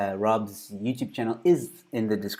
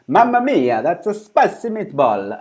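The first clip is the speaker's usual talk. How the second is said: hammed-up Italian accent, much higher and louder; 8 semitones higher, 12 dB louder than usual. Shown as a demonstration. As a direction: belt it out like a cartoon Italian chef, Italian accent. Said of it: "I gotta say it with an Italian accent".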